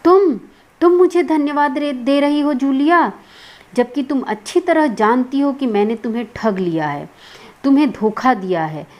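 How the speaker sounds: indignant acted telling-off; angry near-shout. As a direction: act out losing your temper, almost shouting. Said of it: "ऊंचे स्वर में लगभग चिल्लाते हुए".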